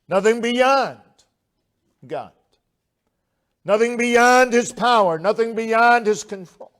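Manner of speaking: a pulpit crescendo, phrase after phrase; preaching climax. Acted. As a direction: drive each line home to the congregation. Rising sermon climax.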